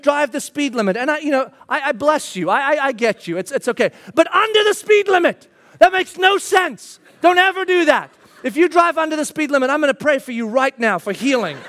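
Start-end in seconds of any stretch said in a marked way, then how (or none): 4.1-8.1 high, emphatic comic indignation; mock outrage. Real, not acted. Preacher telling off anyone who drives under the limit, mock outrage. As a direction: scold them in high-pitched disbelief.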